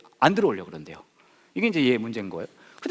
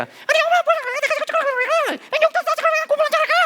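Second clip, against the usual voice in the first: high, fast, louder mock whiny teen; bratty daughter; 6 dB louder, faster than usual. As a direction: snap back at mom in a squeaky rush. Daughter talking back. (엄마에게 대드는 딸, 높고 빠르게)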